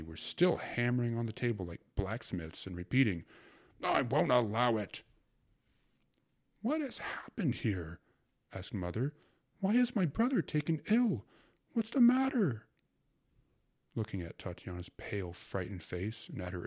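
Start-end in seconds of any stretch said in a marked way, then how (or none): 3.8-5.0 angry. A narrator's reading. Men hammering on the table. 6.6-12.7 alarmed mother. A narrator's reading.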